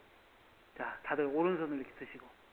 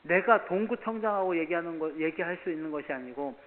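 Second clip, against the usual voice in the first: stern retold scolding; 3 semitones higher, 8 dB louder than usual. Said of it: "왜 그런지 얘기를 했어요".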